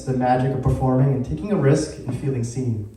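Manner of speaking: normal talking voice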